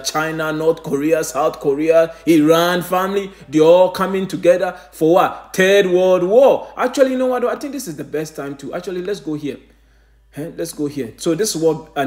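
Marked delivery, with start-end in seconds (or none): 5.5-6.7 drawn out, each word weighted; slow dramatic proclamation. Acted. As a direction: announce it like doom, slowly. Climax of prophecy list.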